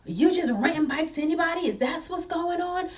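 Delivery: high-pitched, accusing interrogation; hammed-up indignant officer demands; mock yelling officer. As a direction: imitate a cop scolding, pitched well up. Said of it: "So she's yelling at the owners of the stand".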